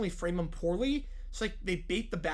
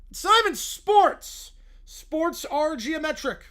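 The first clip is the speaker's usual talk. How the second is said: put-on whiny kid; 7 semitones higher, 6 dB louder, slower than usual. Kid character complaining.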